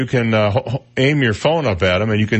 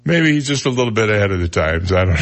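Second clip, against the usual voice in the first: ordinary delivery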